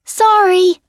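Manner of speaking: high, loud called-out sorry; yelled apology. A narrator's reading. Alice apologizing to the counselor while running, loud call. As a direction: shout it back over your shoulder. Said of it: "Alice yells out".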